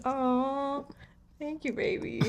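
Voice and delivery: soft, high touched aw; tender. Real, not acted. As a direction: melt a little at the sweet words. Touched by his Ukrainian words.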